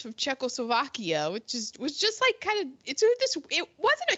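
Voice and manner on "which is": normal talking voice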